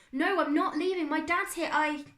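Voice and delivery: high, loud, frantic refusal; shouted protest. A narrator's reading. Boy refusing to leave his dad, shouted protest. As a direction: shout it while pulling away.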